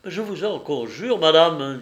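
voix troublée qui monte, suppliante; voix altérée. A narrator's reading. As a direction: supplier d'une voix émue. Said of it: "d'un ton de voix altéré". (d'une voix altérée, il la supplie)

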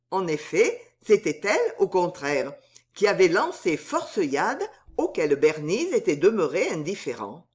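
ordinary delivery

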